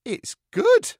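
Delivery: loud, high puppet exclamation; impressed. Acted. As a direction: burst out high and loud with admiration. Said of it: "I said, impressed".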